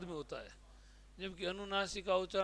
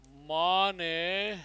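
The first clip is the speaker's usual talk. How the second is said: slow dictation; 6 dB louder, much slower than usual. Shown as a demonstration. Teacher dictating an example sentence.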